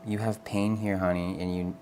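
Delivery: usual speaking voice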